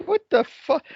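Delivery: high, stunned what the fuck; mock outrage. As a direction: act baffled at the screen. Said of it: "I'm like".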